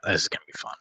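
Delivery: whispered under the breath, dry and ironic; sarcastic whisper. Real, not acted. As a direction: mutter it to yourself, dreading the roll. Player dreading a roll with no bonuses.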